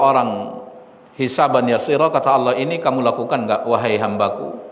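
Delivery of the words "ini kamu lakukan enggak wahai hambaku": low, soft, quick question; gentle, loving. Retold or imitated. Allah questions His servant on small sins, gently. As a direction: ask tenderly, without accusing. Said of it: "kata-kata yang sangat lembut dan penuh kasih sayang".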